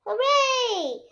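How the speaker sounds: high, bright cheer. A narrator's reading. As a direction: cheer like a happy child. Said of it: "cheers Peppa".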